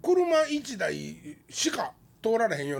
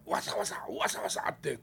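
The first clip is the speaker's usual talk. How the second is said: hushed whisper of rustling; hushed creepy ghost story; 5 semitones lower, 7 dB quieter than usual. Retold or imitated. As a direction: whisper it like something lurks nearby. Spooky rustling in the mountains.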